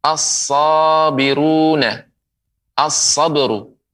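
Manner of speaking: slow "as-sabiruna" and "As-sabru" with a held s; merged article. Shown as a demonstration. Quran teacher models the letter sad, merged article. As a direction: stretch the double s in "as-sabiruna" and "As-sabru". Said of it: "Ya, melebur".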